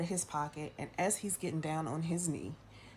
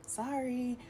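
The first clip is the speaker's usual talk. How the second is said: a higher, quick "Sorry"; a steadier little apology; cleaner, shorter "Sorry"; 7 semitones higher than usual. Retold or imitated. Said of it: "I was just like".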